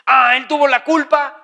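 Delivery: high, loud mock blame; accusing. Preacher mimicking people who blame the other person, accusing.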